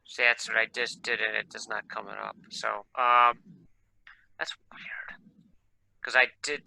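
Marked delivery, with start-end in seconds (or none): 4.4-5.2 whispering. Real, not acted. Muttering to himself at computer.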